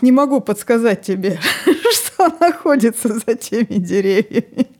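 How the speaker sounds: patient talking tree, laughing through; a laughing, patient tree explaining. Retold or imitated. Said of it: "дерево выдерживает терпеливую паузу и".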